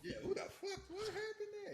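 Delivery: breathless high laugh through the words; laughing at the movie stunt, incredulous; laughing in disbelief. Real, not acted. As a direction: squeak it out while cracking up.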